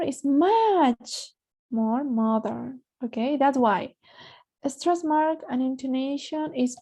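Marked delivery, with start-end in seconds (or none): 0.0-2.8 MUCH drawn out and raised in "It's much more modern"; stressed MUCH. Shown as a demonstration. Teacher showing the class the stress mark, MUCH stressed and drawn out. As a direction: stretch and lean hard on MUCH, slow. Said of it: "can you notice here?".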